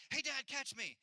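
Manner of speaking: put-on high, bright call of a kid mid-jump; child calling out. Son calling to his dad to catch him, high and eager. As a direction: call like an eager seven-year-old.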